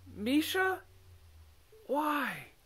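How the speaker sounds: high, incredulous cry; can't believe it was her; shocked disbelief. Acted. Smuggins reels at the king's choice, shocked.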